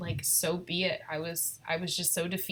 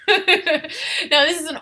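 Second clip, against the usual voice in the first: laughing through the words, louder; 9 semitones higher, 10 dB louder, much slower than usual. Real, not acted. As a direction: still giggling as you speak. Laugh after baby aside.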